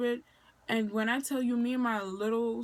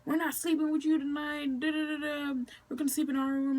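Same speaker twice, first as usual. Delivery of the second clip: sisters; 5 semitones higher than usual. Retold or imitated. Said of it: "So they were just like".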